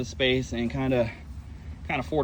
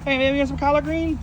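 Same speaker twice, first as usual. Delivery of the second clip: high pet voice; 12 semitones higher than usual. Real, not acted. Baby talk to tortoise.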